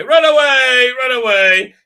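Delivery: high, loud hammed-up cry to flee; mock panicked yell. Reviewer mocking the crew running off, yelling. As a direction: yell it like fleeing in comic panic.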